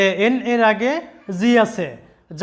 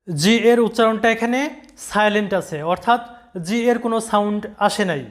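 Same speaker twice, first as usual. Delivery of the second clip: ordinary delivery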